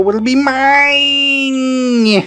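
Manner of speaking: high, slow villain proclamation; Skeletor. Pretend. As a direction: gloat like a cartoon villain. Voicing Skeletor.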